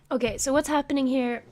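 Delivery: ordinary delivery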